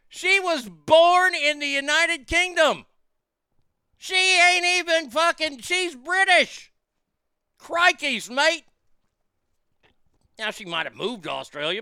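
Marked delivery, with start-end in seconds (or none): 0.1-6.7 high, loud, drawn-out disbelief, slowing on British; incredulous yell. Real, not acted. Host ranting to listeners, incredulous high yell. 7.6-8.6 mock Australian. Mocking an Australian catchphrase.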